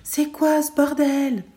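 high, slow, loud furious yell; yelling mother. Acted. Limping mother yells at her son in fury. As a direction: bellow it out at the child. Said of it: "elle hurle".

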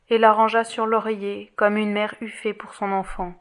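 ordinary delivery, nothing marked

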